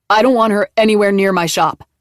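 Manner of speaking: loud angry snap; snapped. Acted. Bleeding man snapping at the police, loud. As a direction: bite it off at the officer. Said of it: "Nick snapped".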